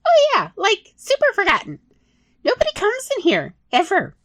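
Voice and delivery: high excited rat voice; chatty rat. Acted. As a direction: gush the gossip.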